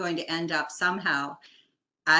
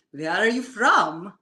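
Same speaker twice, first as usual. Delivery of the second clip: booming voice; 3 semitones higher, 7 dB louder than usual. Retold or imitated. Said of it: "booming voice".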